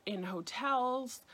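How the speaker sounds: ordinary delivery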